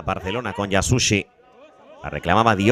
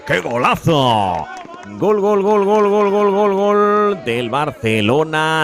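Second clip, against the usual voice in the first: goal celebration; 8 semitones higher, 4 dB louder, much slower than usual. Real, not acted. Goal scored, commentator celebrates.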